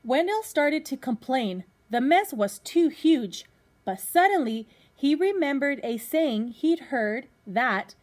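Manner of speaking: lively storybook reading to a class; reading to children. Acted. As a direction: read it out like a teacher at story time. Teacher reads picture book to class.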